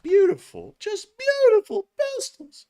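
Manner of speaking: very high mock-swooning squeal; gushing falsetto. Host gushing over old pistols, playful falsetto. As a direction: soar into falsetto with adoration.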